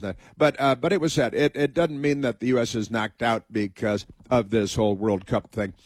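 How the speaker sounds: ordinary talk, nothing marked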